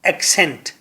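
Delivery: soft falling ایکسینٹ, ek-sent; say accent. Shown as a demonstration. Teacher and class say ایکسینٹ together. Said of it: "Say with me".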